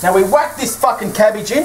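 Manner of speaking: usual speaking voice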